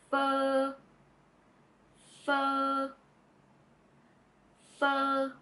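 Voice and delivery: pho held long, dipping down then rising; Vietnamese pho. Shown as a demonstration. Tutor models the whole word pho for learners. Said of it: "Pull them together, we got".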